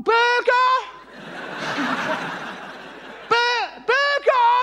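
high, drawn-out order into a speaker; hesitant ordering. Acted. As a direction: call it up high, unsure you are heard. Customer calling into drive-in speaker.

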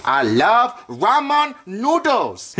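slow and drawn out. Pretend. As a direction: savour each word slowly. Drawn out love for noodles.

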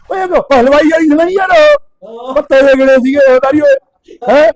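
put-on loud, high, rowdy drunk ribbing; drunk rant. Drunk friend on the phone rants loudly at the narrator. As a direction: hammer the insults like a merry drunk. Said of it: "ਨਸ਼ਾ ਪੱਤਾ ਕਰਕੇ ਟੈਟ ਹੋਇਆ ਫਿਰਦਾ".

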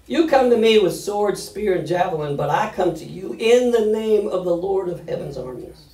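loud, high declamation as David; forceful scripture reading. Acted. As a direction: proclaim it defiantly to the giant. Dramatic reading of David's reply.

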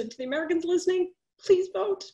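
an urgent heartfelt appeal, pitch rising on please vote; emotional plea. Real, not acted. To the American listeners, an emotional, high-pitched plea to vote. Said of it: "I'm getting emotional about this".

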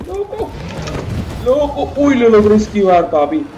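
panicked yelling while dodging; frantic shout, escaping barely; being chased. Real, not acted. Fleeing Nemesis, dodging him.

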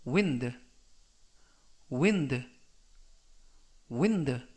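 "wind" with a short i, falling; careful pronunciation demo. Shown as a demonstration. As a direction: model "wind" like the breeze, not the verb. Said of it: "라고 발음하시면 되겠고요".

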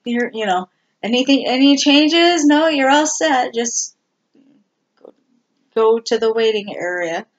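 high, loud imitation of a front desk voice; brisk receptionist. Receptionist waving the patient on to the waiting area, high loud imitation. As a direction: rush the patient through like a busy receptionist. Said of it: "they're like".

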